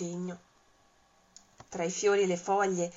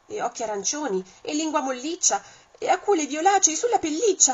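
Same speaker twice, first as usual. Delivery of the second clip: "e occhi arancioni, e lingua molliccia" rising higher and louder to a near shout; building alarm; 8 semitones higher, 7 dB louder, faster than usual. Shown as a demonstration. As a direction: list the features with mounting panic. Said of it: "la descrizione ritorna davanti al mostro". (elenco sempre più acuto e forte fino al grido)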